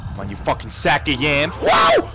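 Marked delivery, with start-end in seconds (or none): 1.6-2.1 very high, loud "whoa"; sudden shriek of alarm; short falling screech; startled whoop. Real, not acted. Startled by attack.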